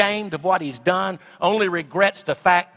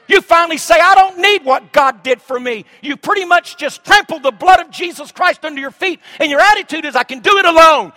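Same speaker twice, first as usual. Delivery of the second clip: shouted, much higher and louder sermon climax; full-force preaching; 8 semitones higher, 8 dB louder than usual. Acted. Pastor warning the congregation they cannot go it alone, shouting. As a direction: drive it home at the top of your voice.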